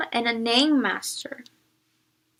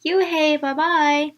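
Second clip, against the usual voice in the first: put-on louder, higher kids' taunting call; yelled; 5 semitones higher, 6 dB louder, slower than usual. A narrator's reading. Kids on the bus yelling goodbye to tease her. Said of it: "the kids yelled".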